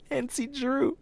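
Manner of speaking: high, slow laughing delivery of the name. Real, not acted. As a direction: giggle it out, embarrassed. Laughing at his own confession.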